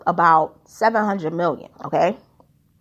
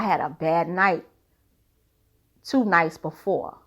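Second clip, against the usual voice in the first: lower, mumbled fake-sorry excuse; mock abuser apology; 5 semitones lower than usual. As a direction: mumble a half-hearted sorry, lightly mocking. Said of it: "They will say something like".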